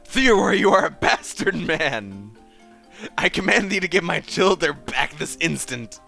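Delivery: high, loud theatrical outrage; outraged command. Acted. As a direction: proclaim it at the foe, loud and high. Villain confronts Theodore, demands children.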